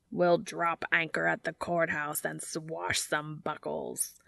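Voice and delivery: hammed-up, slow, drawn-out pirate growl; cheerful pirate accent. A narrator's reading. Dean plans the courthouse wedding, cheerful drawling pirate accent. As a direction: relish it like a stage pirate. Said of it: "Dean replied cheerfully".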